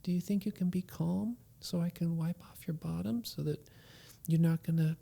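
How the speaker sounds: soft whispering father; father whispering comfort to his son; tender whisper. Retold or imitated. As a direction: whisper love to calm him. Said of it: "It's like".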